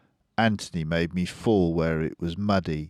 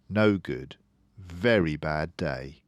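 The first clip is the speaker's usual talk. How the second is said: ordinary delivery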